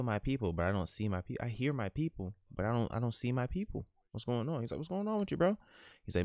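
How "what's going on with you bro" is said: higher-pitched casual greeting; higher buddy voice. Pretend. Friend greets D-Lo in a higher put-on voice. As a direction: lift the voice way up as a friend.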